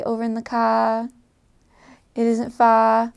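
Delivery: Australian accent, "car" and "far" ending in an open "ah". Shown as a demonstration. Showing how Australians say R, Australian accent. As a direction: drop the R's like an Australian. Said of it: "in their accent, with the, when the R sounds like an ah".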